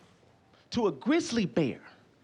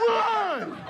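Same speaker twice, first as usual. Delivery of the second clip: high, drawn-out yell; calling out; 12 semitones higher than usual. Acted. Comic answers what to do, hollering high. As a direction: holler it high as an urgent order.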